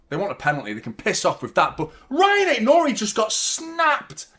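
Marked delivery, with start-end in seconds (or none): 2.1-4.3 high, slow outcry; outraged cry. Real, not acted. Fan insisting to viewers the player was hurt, outraged. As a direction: lean into the outrage.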